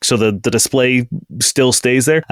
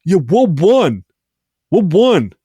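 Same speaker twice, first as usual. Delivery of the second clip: confused Brackum calling back; 8 semitones higher, slower than usual. Acted. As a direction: shout back, baffled, across the room. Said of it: "he turns around and goes".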